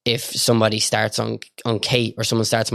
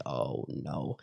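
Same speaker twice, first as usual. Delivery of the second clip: low, much softer dismayed groan; dread; 8 semitones lower, 15 dB quieter than usual. Retold or imitated. Speaker seeing four lads arrive, hushed dread. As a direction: drop it in quiet horror.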